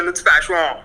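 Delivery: passion said in a fussy correct way, mock refined; correcting how passion sounds, snobbishly; posh correction. Karan Johar type fixing your pronunciation, prissy. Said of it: "pronunciation ठीक करते हैं".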